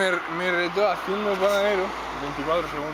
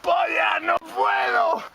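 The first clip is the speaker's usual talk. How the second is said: strained, breathless cry; straining gasp; 7 semitones higher, much slower than usual. Real, not acted. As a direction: push it out with no air.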